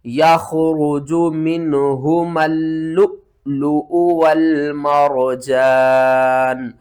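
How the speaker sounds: slow, drawn-out tajweed recitation; Quran recitation. Shown as a demonstration. Teacher demonstrates the correct reading, slow and melodic. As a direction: stretch each syllable like a reciter. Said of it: "तो सही पढ़ने का तरीका क्या है?".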